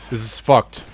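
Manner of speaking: frustrated, loud but not yet shouting; contained frustration; exasperated complaint. Real, not acted. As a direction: grumble firmly that it's hopeless. Losing in game, first take.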